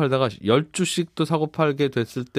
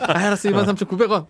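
lightly played auctioneer, higher; 8 semitones higher, 4 dB louder than usual. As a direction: call the next bid like an auctioneer. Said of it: "뭐 이러면".

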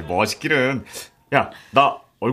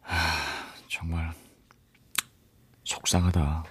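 sad sigh; 7 semitones lower, 9 dB quieter, slower than usual. Acted. Sad about his situation.

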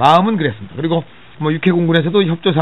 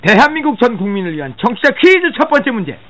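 quiz announcer; 8 semitones higher, 4 dB louder than usual. Acted.

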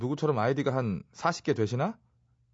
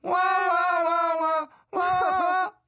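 high, loud chant of wa; mock crowd cheer; 4 dB louder, much slower than usual. Mock crowd cheer after story.

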